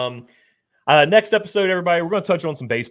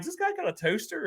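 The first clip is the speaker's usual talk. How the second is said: incredulous; 6 dB quieter than usual. Retold or imitated. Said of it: "I'm like".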